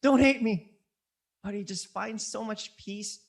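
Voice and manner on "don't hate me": high, loud put-on pleading; exaggerated defensive yelp; mock knee-jerk reaction. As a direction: blurt it out high and loud, imitating a panicky reaction. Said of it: "not a reaction".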